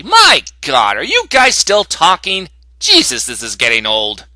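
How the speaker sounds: loud, fed-up interruption; exasperated outburst. Acted. Reviewer cutting in on characters still talking, loudly exasperated. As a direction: break in loudly, out of all patience.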